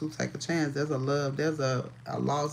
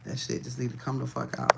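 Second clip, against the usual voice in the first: ordinary talk, nothing marked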